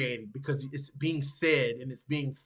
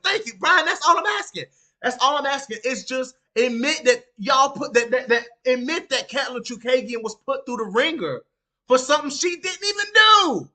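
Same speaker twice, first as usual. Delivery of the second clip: vindicated shout; 6 semitones higher, 6 dB louder than usual. Retold or imitated. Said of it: "Brian went".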